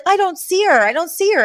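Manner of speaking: high-pitched put-on character voice; Fran. Looking out at the limo from the doorway, high imitation. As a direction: say it high, imitating someone searching.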